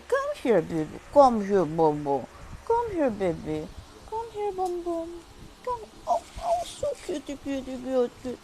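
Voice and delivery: high, sing-song cooing at a pet; squeaky pet talk, calling the pup close. Real, not acted. Calling and cooing to her dog.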